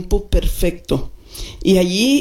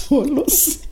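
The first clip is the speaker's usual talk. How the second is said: ordinary talk, nothing marked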